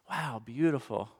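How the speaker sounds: ordinary talk, nothing marked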